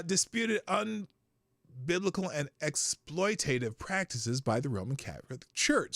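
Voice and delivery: ordinary delivery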